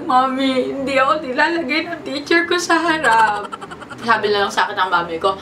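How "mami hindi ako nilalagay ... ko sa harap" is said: lightly imitated childish whimper, a touch higher; mock crying to mom. Little girl complaining to her mami about the dance, mock teary whine. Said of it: "umiiyak ako sa mami ko".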